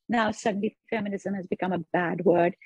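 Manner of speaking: ordinary talk, nothing marked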